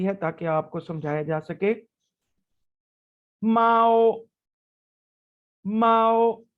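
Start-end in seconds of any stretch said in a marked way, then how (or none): 3.4-6.4 माओ sung out with a first-tone level pitch; Chinese syllable mao. Shown as a demonstration. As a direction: hold माओ high and even. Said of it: "बिल्ली को कहते हैं".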